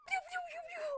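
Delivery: high, soft mouth blaster sounds; pew pew gun noises. Pretend. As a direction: make toy laser noises. Mimics shooting sounds.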